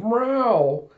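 high, stretched-out yowl; imitated cat meow. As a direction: meow like a put-out cartoon cat. Imitated cat man complaining of heat.

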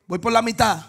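ordinary talk

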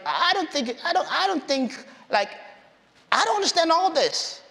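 high-pitched, hesitant protest; acted self-doubt. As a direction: stumble into it, unsure of yourself. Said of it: "it was a fear of inadequacy".

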